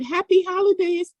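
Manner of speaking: put-on high, singsong child greeting; imitating a four-year-old's high sing-song greeting; little girl singsong. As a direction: chirp it like a tiny girl wishing everyone well. Child greets lady in store.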